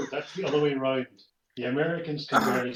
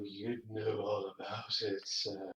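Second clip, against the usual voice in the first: menacing; 5 semitones lower, 10 dB quieter than usual. Acted. Menacing drop in level.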